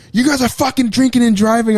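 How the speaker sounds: high-pitched accusing imitation; mock outraged parents. Parents accusing their son over the car, mock outrage. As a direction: imitate parents scolding, pitched well up but not shouted.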